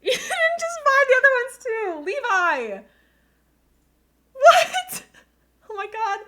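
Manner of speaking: very high, loud, laughing near tears; crying-laughing squeal at the gift; overwhelmed squeal. Real, not acted. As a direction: shriek with joyful disbelief. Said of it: "My mic is maxing out right now".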